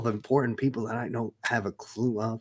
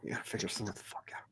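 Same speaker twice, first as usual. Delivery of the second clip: urgent whisper; 10 dB quieter, much faster than usual. Acted. Ron hissing advice at the table.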